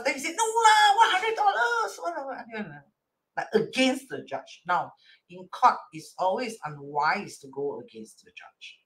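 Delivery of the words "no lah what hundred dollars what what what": indignant protest. Acted. Litigant defying the judge's hundred-dollar order, indignant protest. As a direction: protest with a mock-outraged wail. Acts out someone arguing against the judge.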